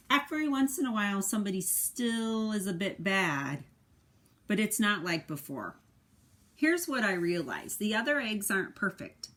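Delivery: expressive picture-book narration; reading to children. A narrator's reading. Parent reading the egg's story to a child, expressive narration. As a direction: tell it to a little one, with feeling.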